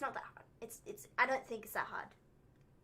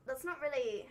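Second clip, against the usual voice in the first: ordinary talk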